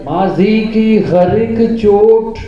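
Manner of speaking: slow, drawn-out verse, rising high and loud; mushaira recitation. Acted. Poet reciting his rubai to the gathering, declamatory. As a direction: declaim the couplet, stretching and repeating each phrase.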